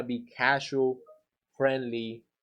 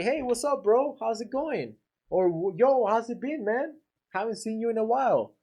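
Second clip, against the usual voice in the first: put-on higher, bright casual hello to an old buddy; friendly greeting; 7 semitones higher than usual. Shown as a demonstration. Painter greets homeowner like a buddy, higher and casual. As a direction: greet him like a friend you've missed. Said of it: "How do you talk to a friend".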